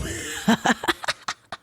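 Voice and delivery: quick airy chuckle; breathy laugh. Real, not acted. Laughs at own joke.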